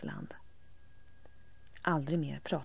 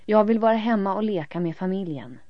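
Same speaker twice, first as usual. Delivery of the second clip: loud insistence; 4 semitones higher, 10 dB louder than usual. A narrator's reading. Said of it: "säger Elin".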